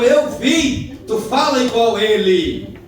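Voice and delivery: high, loud accusing voice; loud accusation. Acted. Accuser confronting Peter, loud and accusing. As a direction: expose him before everyone.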